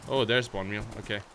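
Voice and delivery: usual speaking voice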